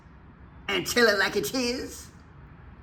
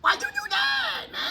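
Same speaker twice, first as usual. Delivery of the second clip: much higher, squeaky cartoon character shout; 4 dB quieter than usual. Shown as a demonstration. Reacting to the governor's flag video in a cartoon voice. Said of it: "I gotta get my cartoon voice on that one".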